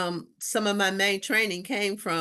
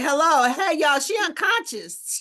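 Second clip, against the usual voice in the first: high, loud mock alarm; mock alarmed call; 8 semitones higher, 5 dB louder than usual. Speaker alerting the show crew, high loud mock alarm. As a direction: call out to the room in mock panic. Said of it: "I was like".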